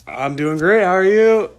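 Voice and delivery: loud, rising retold phone greeting; hammed-up cheery reply. Answering dad on the phone, loud mock-cheery. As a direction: play back an overeager hello, voice climbing high.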